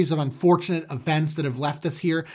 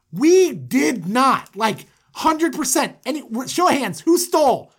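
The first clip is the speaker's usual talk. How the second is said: mock protest; 7 semitones higher than usual. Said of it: "And they're like".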